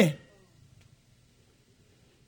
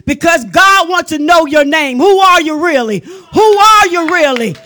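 raised, urgent preacher's questioning; fiery preaching; 3 semitones higher, 5 dB louder than usual. Real, not acted. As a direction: fire off challenges to the congregation. Preaches at full force.

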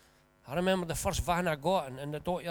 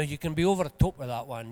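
normal talking voice

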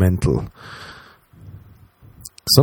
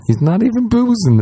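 alarmed; 14 semitones higher than usual. Retold or imitated. Inner panic about angry date.

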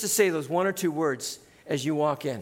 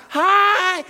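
bursting "Hi", much higher and louder; genuine hi; 11 semitones higher, 9 dB louder than usual. Shown as a demonstration. Pastor showing the congregation a genuine greeting, bursting with joy. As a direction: greet like you're thrilled to see them. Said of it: "be genuine about it".

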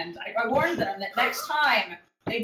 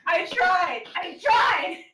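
higher, louder, rising to a shout; laughing shout; 6 semitones higher, 5 dB louder, slower than usual. Real, not acted. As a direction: yell it, cracking up. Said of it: "couldn't keep a straight face".

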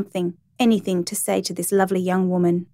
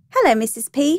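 put-on high child's greeting; little girl; 6 semitones higher than usual. A narrator's reading. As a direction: greet them like a small girl.

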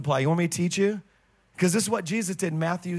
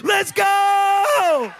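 loud, much higher rallying yell; preacher shouting his own heaven cheer; triumphant shout; 12 semitones higher, 6 dB louder than usual. Retold or imitated. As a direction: cheer it out at full force. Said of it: "I'm like".

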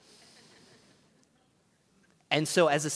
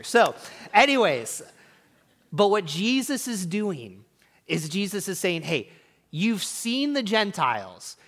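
building intensity; 7 semitones higher, 7 dB louder than usual. Real, not acted. Preaching climax, rising intensity.

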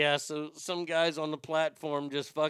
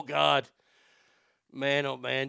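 usual speaking voice

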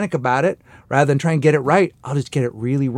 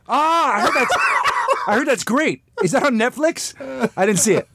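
mock clueless guest; 11 semitones higher than usual.

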